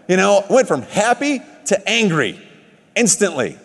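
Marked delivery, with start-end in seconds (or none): none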